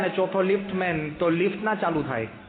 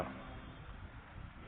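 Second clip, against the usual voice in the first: scared whisper; 24 dB quieter, much slower than usual. Retold or imitated. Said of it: "ગભરાતા કા".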